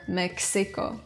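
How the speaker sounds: Mexico as "mek-SEE-ko". Shown as a demonstration. Teacher showing what it is not, Mexico as "Meksiko". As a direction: say Mexico with a hard k-s for the x. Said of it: "not Mexico or Mexico".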